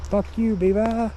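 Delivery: high, loud yell at the beaver. Real, not acted. Drunk man yelling at a beaver in the river, loud and high. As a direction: shout it out across the river.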